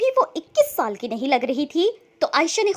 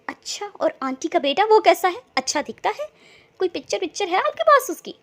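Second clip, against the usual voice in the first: higher, louder eager questions; excited; 5 semitones higher, 5 dB louder than usual. A narrator's reading. Rubab asks her sister about the groom, excited. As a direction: burst with curiosity about the groom. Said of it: "Rubab excited होती हुई बोली".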